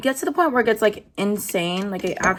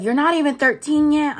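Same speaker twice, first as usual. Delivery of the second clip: mocking taunt; 6 semitones higher, 5 dB louder than usual.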